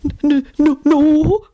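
high, terrified stutter; panicked, high-pitched stuttering; frightened stammer. A narrator's reading. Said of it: "Albert stammered".

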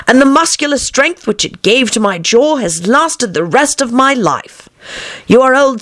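put-on high, lilting girlish verse; poem recitation. A narrator's reading.

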